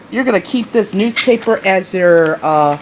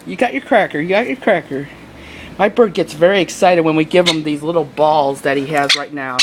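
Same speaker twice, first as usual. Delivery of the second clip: ordinary talk, nothing marked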